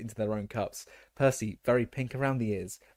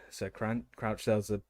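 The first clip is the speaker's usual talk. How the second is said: plain reading voice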